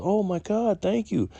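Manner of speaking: high-pitched, imitated amazed thanks; disbelieving gratitude. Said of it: "she couldn't believe I gave it to her".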